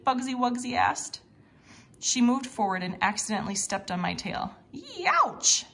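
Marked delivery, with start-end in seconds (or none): 4.7-5.7 sudden high yelp of pain. Acted. Uncle yelps when his tail is stepped on, high pained yelp.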